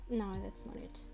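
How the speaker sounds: usual speaking voice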